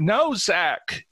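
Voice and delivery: high, urgent cry; acted scream, pitched high; screamed warning. A narrator's reading. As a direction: call out desperately to stop him. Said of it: "she screamed".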